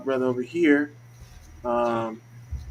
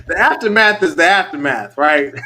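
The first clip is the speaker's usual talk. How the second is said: loud, raised voice through laughter; laughing; 2 semitones higher, 9 dB louder than usual. Real, not acted. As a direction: crack up while saying it. Laughing at own line.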